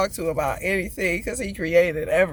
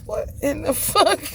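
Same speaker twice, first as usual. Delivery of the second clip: laughing disbelief; 10 semitones higher, 3 dB louder than usual. Real, not acted.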